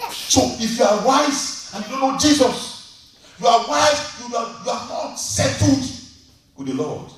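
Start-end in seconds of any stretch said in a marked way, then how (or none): 0.1-5.8 high, ringing, drive home the word wise; emphatic preaching. Acted. Preacher hammering a point home.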